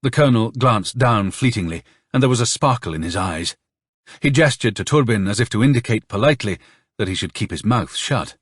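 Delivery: plain reading voice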